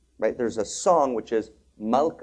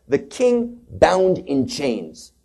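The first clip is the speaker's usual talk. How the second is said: solemn; 3 semitones higher, 3 dB louder, much slower than usual. Acted. Quoting verse dramatically.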